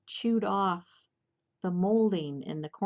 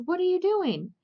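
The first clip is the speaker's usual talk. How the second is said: much higher and louder, aghast; shocked scold; 12 semitones higher, 7 dB louder than usual. Retold or imitated. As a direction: catch the bird in the act and yell. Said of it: "and she goes".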